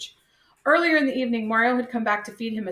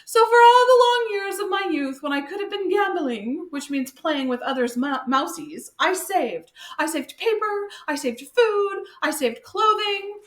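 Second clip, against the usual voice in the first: high, louder pitiful whine; hammed-up high pitiful mouse voice; 8 semitones higher, 4 dB louder than usual. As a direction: wail it like a self-pitying miser. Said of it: "His voice became high and pitiful again".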